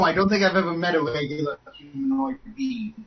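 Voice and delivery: usual speaking voice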